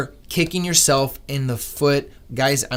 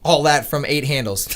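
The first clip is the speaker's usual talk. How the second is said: normal talking voice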